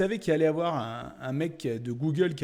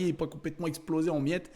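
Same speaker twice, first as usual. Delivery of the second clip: ordinary delivery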